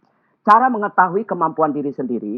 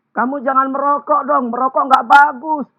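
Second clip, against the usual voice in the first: higher, scolding nag about smoking; preachy scolding; 8 semitones higher, 3 dB louder than usual. Shown as a demonstration. Speaker mimicking a smoker lecturing others not to smoke, preachy. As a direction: wag a finger with your voice. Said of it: "Jangan bilang".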